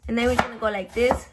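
ordinary talk

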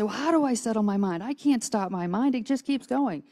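high-pitched, plaintive imitation; lightly hammed-up helplessness; mock helpless complaint. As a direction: voice someone who can't switch off. Voices people's complaint about racing mind.

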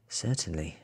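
ordinary delivery

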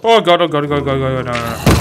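loud, frantic repeated pleading; panicked. Real, not acted. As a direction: babble it in panic. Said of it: "That really did scare me".

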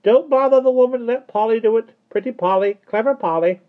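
very high, loud squawk; loud croaky parrot. A narrator's reading. Parrot umbrella scoffing from inside the room, loud croak. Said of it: "said a loud, croaky voice".

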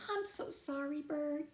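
high-pitched small apology; apologetic Rabbit. Acted. Rabbit apologises to Bird after the crash, high and sorry. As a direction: lift the voice, lightly contrite.

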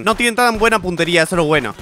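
ordinary talk

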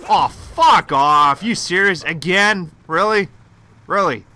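loud, disbelieving really; real annoyance, loud; angry outburst. Real, not acted. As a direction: snap at the game in disbelief. Killed again, exasperated.

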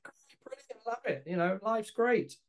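forced high bright "all great" voice; sing-song pretend everything's fine; fake cheerful. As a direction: put on a falsely cheery front for the parents. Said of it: "pretending everything was okay".

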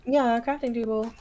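ordinary talk

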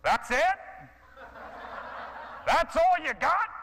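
high, loud taunting one-upping shout; yelling challenge. Retold or imitated. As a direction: yell back mockingly from the back of the room. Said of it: "I hear this voice yelling".

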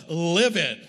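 slow, heavy punch on livid; emphatic LIVID. Real, not acted. Preacher telling of mom's anger, hammering the word livid. Dramatizes how angry mom was.

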